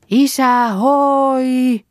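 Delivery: loud high holler, last word held long; calling out. Acted. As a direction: call out to someone far away. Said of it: "Vielä".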